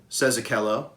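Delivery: plain reading voice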